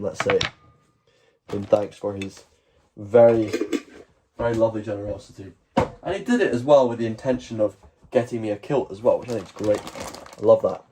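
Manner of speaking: ordinary delivery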